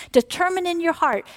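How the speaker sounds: ordinary delivery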